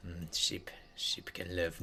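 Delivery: soft unvoiced whisper. Real, not acted. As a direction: whisper it to the sheep. Whispering to the sheep.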